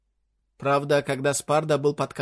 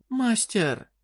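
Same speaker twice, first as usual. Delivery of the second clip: fairy queen voice; 8 semitones higher than usual. Acted.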